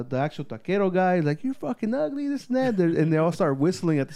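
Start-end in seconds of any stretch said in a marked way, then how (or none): 1.4-2.8 high-pitched imitated insult; retold taunt pitched way up; mock heckling. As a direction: jeer in a high voice without shouting.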